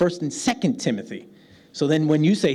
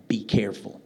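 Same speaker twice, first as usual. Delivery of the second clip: normal talking voice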